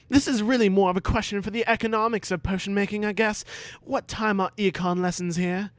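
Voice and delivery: breezy clever pupil dodging. Acted. Harry brushing off Snape, quick high boy.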